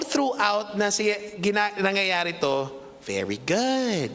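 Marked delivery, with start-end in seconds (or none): 3.1-4.1 slow, drawn-out very good; imitating potter's praise. Preacher imitating Nanay Tessie praising Hannah, slow and drawn out. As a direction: savor the praise like the old potter. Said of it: "Sabi niya".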